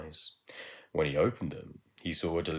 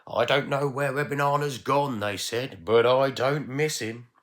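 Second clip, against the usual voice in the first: loud, higher gossiping veg; mocking gossip; 7 semitones higher, 8 dB louder than usual. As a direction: sneer it like gossiping relatives. Vegetables mock Ebenana.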